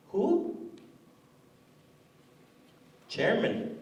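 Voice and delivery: bored, offhand receptionist with long pauses. Acted. Bored band office secretary fielding calls about the absent chairman, offhand and slow.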